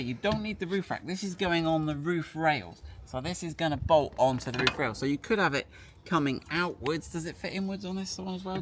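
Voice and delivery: usual speaking voice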